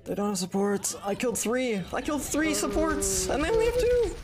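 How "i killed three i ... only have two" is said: excited outrage. Real, not acted. Outraged at enemy team comp.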